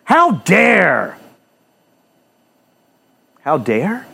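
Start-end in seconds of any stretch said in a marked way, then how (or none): 0.0-1.2 mock outrage. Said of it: "How dare they tell a woman".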